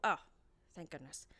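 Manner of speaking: ordinary delivery, nothing marked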